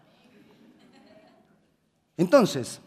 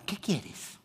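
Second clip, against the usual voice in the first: whispered genie voice; a hint of a mysterious genie; mock genie; 6 semitones higher, 4 dB quieter than usual. Genie emerging from the lamp, whispered.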